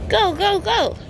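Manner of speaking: high, bright cheering a little one. Real, not acted. Cheering toddler on.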